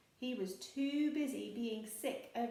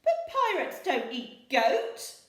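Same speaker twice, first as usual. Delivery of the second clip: much higher, loud, slow protest; indignant pirate; 9 semitones higher, 15 dB louder, slower than usual. A narrator's reading. Handkerchief refuses goat stew, indignant. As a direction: insist on pirate rules. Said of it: "said Handkerchief".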